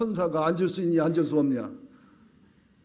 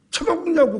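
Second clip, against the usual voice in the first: 쳐먹냐고 repeated much higher and louder, a hammed-up shriek; shrieking wife; 12 semitones higher, 5 dB louder than usual. Wife topping her retort to husband, hammed-up shriek. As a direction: top it with a mock shrill 쳐먹냐고. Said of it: "성질에서 막 싸웠답니다".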